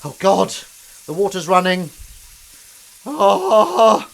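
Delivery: high, loud, alarmed outburst; shocked yelp at the noise; startled cry. Real, not acted. Startled by running water.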